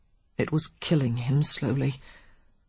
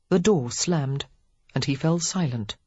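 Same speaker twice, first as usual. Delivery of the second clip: usual speaking voice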